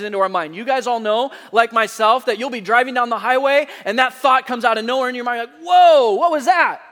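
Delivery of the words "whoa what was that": louder, higher sudden whoa; startled. Pretend. Driver startled by a stray thought on highway, surprised. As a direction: jolt as if something flew past.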